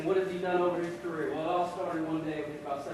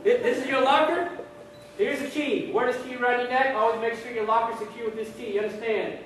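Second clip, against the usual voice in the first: higher-pitched, forceful instructor; drill sergeant; 6 semitones higher, 3 dB louder than usual. Acted. Drill sergeant explaining lockers to recruits, firm commands. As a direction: rattle off orders like a drill sergeant. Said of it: "Drill sergeant says".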